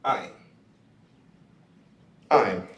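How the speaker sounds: "I'm" said carefully, spaced apart; Hebrew pronunciation. Shown as a demonstration. Teacher modelling Hebrew word for the class. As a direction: give "I'm" slowly for them to copy. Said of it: "I'm is how you say it in Hebrew".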